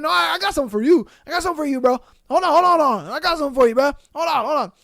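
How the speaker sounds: high, flustered repeated threats; mock threat. Cook going off to fetch a weapon, mock angry. Acted kitchen quarrel.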